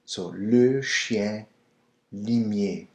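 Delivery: chien limier spelt out very slowly; le chien limier, stretched; slow French. Shown as a demonstration. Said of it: "it's always le".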